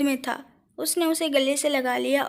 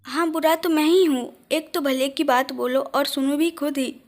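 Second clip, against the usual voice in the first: ordinary delivery, nothing marked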